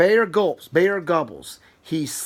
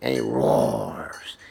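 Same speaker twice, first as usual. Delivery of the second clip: much lower, slow growled roar; bear roar; much slower than usual. Acted. Reading to a child, voicing the waking bear's roar.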